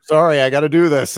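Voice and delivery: high, loud mock apology; mock apologetic announcer. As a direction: ham up a sorry announcer. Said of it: "he's apologizing to us".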